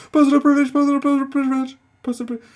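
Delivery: very high, loud, rapid-fire mock interjection; frantic interrupter impression. Reactor mimics delegate jumping up to interrupt, frantic mockery. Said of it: "Was he jumping on going".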